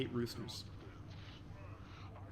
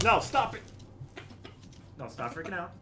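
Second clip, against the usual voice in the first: ordinary talk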